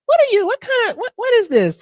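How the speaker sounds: high, loud shocked cry; mock horrified. Real, not acted. Cohost squealing in shock at the name. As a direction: recoil in disgust at the chanting.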